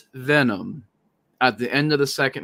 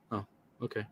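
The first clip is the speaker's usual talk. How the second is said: much softer, limp little okay; mock meek agreement; 3 semitones lower, 16 dB quieter, much faster than usual. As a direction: shrug it off like a pushover. Mocking Peter's easy agreement.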